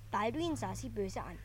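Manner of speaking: ordinary delivery, nothing marked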